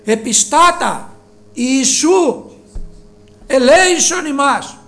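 loud pleading cry for mercy. Retold or imitated. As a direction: call out to Jesus loudly, begging. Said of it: "φωνάζανε δυνατά". (δυνατή ικετευτική κραυγή)

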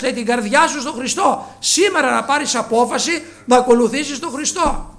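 high, loud preaching call; fervent exhortation. Acted. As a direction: urge them to decide today. Closing exhortation at full force. (ένθερμη προτροπή κηρύγματος)

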